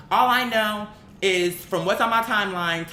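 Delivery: usual speaking voice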